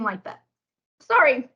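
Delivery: burping mid-sentence from tea; slight burp while drinking tea. Real, not acted. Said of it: "it's kinda making me burp".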